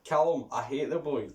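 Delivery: normal talking voice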